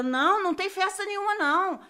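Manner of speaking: the mother cutting her off, firm; insistent mother. Retold or imitated.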